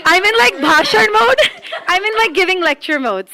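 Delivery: high-pitched, laughing through the words. Real, not acted. Laughing at her own lecturing.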